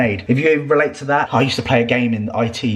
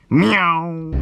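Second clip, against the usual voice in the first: cat meow; 6 semitones higher than usual. Pretend. Imitating a cat.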